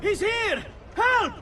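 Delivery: high panicked shout; calling for help. Acted. Guard spotting the assassin, crying for help. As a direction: yell to bring the guards running.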